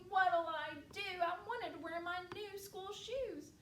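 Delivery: hammed-up dismay, pitched high; despairing little girl. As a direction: wail it as a dramatic girl. Girl laments lost shoe.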